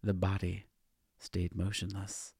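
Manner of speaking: usual speaking voice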